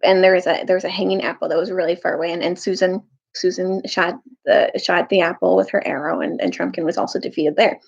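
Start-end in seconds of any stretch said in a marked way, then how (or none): none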